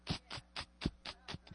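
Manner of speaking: voiceless, soft rapid-fire clicking noises; imitated remote clicks. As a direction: mimic a clicker with dry mouth clicks.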